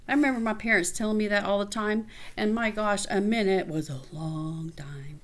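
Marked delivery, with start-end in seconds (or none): 3.1-5.2 hushed, breathy aside; soft whispered joke to the listener. Real, not acted. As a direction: let it drop to a whisper. Aside about parents' long minutes.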